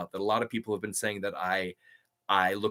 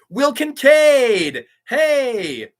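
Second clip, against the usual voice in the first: excited shout; 13 semitones higher, 11 dB louder, much slower than usual. Real, not acted. Spots a viewer's name.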